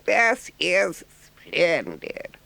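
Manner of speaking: high, slow, gushing toad voice; boastful Toad. A narrator's reading. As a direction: gush over the visitors. Toad's line.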